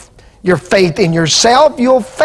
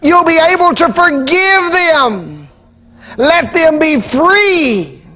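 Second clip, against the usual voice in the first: high, slow, crying plea; urging the congregation to forgive, voice breaking; tearful preaching; 7 semitones higher, much slower than usual. Acted. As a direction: let the tears come as you release them.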